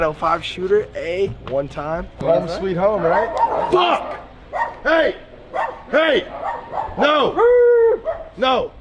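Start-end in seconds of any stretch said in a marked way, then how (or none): 3.7-8.7 sudden high yelps; panicked yelps. Real, not acted. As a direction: yelp in alarm. Keg foam spilling out.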